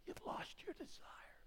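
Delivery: slow, hushed whisper of grief; sorrowful whisper. Acted. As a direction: whisper it slowly like a broken heart. Jesus's sorrowful reply.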